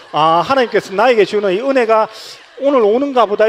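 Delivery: high, loud marvelling at grace; inner wonder. Retold or imitated. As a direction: exclaim in awe of God's grace. 혼자 마음에 품는 은혜. (높고 크게 은혜에 감탄하듯)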